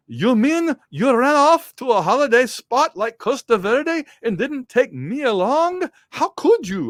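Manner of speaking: high, loud, hammed-up Russian accent; bad Russian accent. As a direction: put on a terrible high-pitched Russian accent. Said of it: "I'll see if I can do her accent here".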